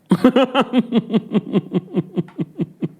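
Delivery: long, high-pitched laughing fit; real high laughter; high giggling laugh. Real, not acted. Laughs at own insinuation.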